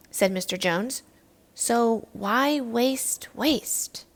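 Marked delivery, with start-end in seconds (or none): 1.5-4.0 slow, drawn-out question; slow slogan. Acted. Teacher sums up the lesson, slowly drawn out. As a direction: stretch every word like a catchphrase.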